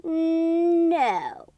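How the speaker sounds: a loud, drawn-out stubborn no; defiant no. A narrator's reading. Thomas refusing the principal, loud and defiant. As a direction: refuse flatly and loudly. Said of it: "And Thomas said".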